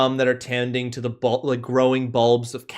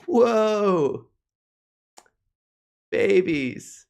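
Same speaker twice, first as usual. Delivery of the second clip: stoned hippie voice; 6 semitones higher, much slower than usual. Acted. Sovereign greets party.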